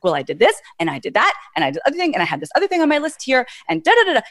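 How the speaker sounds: rushed rule follower. Retold or imitated. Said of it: "hear it in the pace of their voice".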